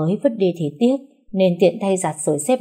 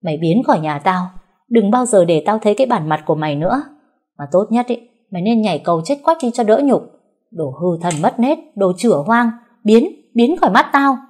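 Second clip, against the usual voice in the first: louder and higher, ending in a screamed order to leave; cursing; 3 semitones higher, 4 dB louder than usual. Acted. Mother-in-law cursing the bride in the street, furious. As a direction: pour out the curses to a final yell. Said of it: "miệng không ngừng chửi rủa".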